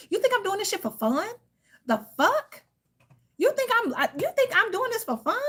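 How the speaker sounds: high-pitched outrage. Real, not acted. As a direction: squeal it in disbelief. Outraged rant to ex.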